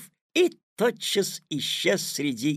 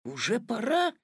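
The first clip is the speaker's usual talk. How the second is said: high rising question; taken aback, pitch lifting; sleepy boy caught by surprise; amazed; 9 semitones higher than usual. A narrator's reading. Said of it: "изумился Петя".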